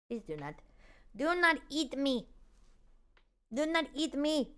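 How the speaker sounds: a scared little cat begging, soft. Acted. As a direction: plead quietly as the frightened cat. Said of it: "give sushi a voice".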